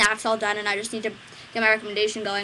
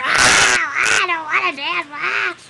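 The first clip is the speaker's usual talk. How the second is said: higher, louder whiny wail; crying child; 7 semitones higher, 10 dB louder, much slower than usual. Retold or imitated. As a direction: wail like an upset little girl. Crying girl quoted.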